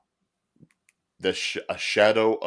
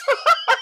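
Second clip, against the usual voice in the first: high laugh; 6 dB louder than usual. Real, not acted. Laughs after pranking friend.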